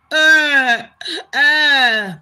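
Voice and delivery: loud scandalised uh-uh; exaggerated shocked grunt. Real, not acted. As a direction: gasp out a loud disbelieving uh. Shocked reaction to being skanked.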